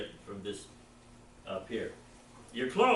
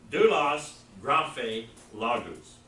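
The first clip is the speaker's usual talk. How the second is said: doulos, graphe, logos read louder, each word set apart for the class; read for repeating; 7 dB louder, much slower than usual. Shown as a demonstration. Teacher reads the Greek words for the class to repeat after. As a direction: read out doulos, graphe, logos clearly so students can echo them. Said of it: "One more time".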